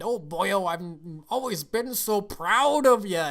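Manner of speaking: loud, high Scottish dad impression; Spitelout. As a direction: gush in a hammed Scottish accent, stretching it out. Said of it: "Spitelout came up and said".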